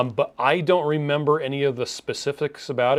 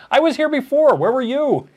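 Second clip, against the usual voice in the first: much higher, louder mock whine; high squeaky voice; 12 semitones higher, 5 dB louder than usual. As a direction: squeal it like a pouty kid. Reading viewer's teasing chat.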